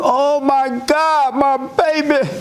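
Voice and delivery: put-on smothering mother cooing over her baby; fussing mama. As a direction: fuss over the fallen baby like a panicked mama. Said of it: "But mama run and grab you".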